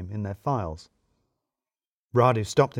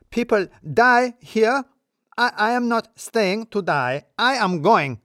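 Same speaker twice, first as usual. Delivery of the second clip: much higher, louder, slow and outraged; furious Radu quitting; 10 semitones higher, 5 dB louder, slower than usual. A narrator's reading. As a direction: storm out shouting. Radu storming out yelling.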